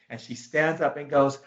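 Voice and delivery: ordinary talk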